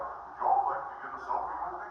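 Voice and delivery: a hint of a folksy Bigfoot asking nicely; friendly Bigfoot. Comic imagines Bigfoot asking tourists, lightly whispered. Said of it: "He's waving, going".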